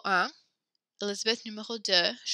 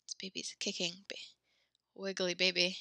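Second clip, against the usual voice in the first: out of breath; 8 dB quieter than usual. Real, not acted. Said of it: "Sorry if I sound so out of breath".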